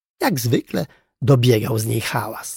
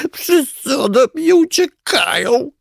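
high, loud, drawn-out wail; loud crying; 14 semitones higher, 5 dB louder, slower than usual. A narrator's reading. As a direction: wail it slowly like a weeping little creature. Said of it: "zaczęło głośno płakać". (głośno zawodząc, z płaczem)

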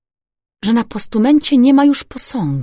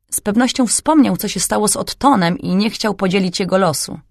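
usual speaking voice

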